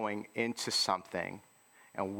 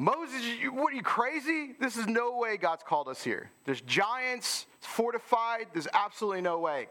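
high, agitated mock yelling; mock panicked crowd; 12 semitones higher than usual. Spies yelling at Moses about giants, high panicked. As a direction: squeal out the panic. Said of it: "they're yelling".

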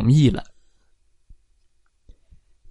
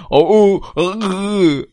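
bear grunt; 7 semitones higher, 3 dB louder than usual. Acted. Bear jumps out growling.

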